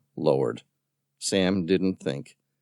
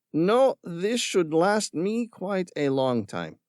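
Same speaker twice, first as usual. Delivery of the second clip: mocking villain; 11 semitones higher, slower than usual. A narrator's reading. Villain scorning Tom's concern for the creatures. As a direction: sneer at the tree hugger. Ramirez voiced.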